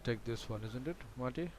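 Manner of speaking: ordinary delivery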